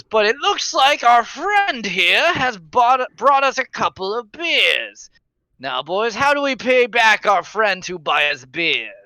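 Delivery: slow, high sly threat; menacing drunk. Acted. Drunk leader sizes up the stranger, slow sly menace. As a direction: grin while you threaten.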